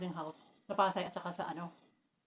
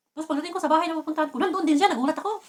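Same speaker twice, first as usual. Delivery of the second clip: louder and higher, caught up in the surprise; excited retelling; 7 semitones higher, 8 dB louder than usual. Retold or imitated. Telling friends how the stranger followed her, excited. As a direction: tell it like you still can't believe it. Nandun din siya, nagulat ako.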